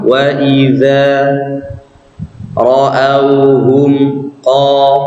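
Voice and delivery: long drawn-out Quranic chant; slow recitation. Shown as a demonstration. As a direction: stretch each vowel of wa idza ra'auhum. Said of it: "seperti bilang dua".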